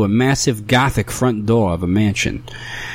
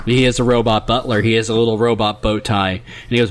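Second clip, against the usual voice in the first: ordinary delivery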